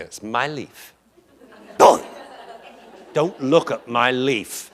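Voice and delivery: lightly voiced dog warning, with long pauses; possessive dog. Whip guarding his leaf from a puppy, slow mock warning. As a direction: warn slowly, word by word.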